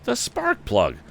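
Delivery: sudden realization, high and eager; excited cry. A narrator's reading. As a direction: it dawns on him, burst out. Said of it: "Then Tom cried".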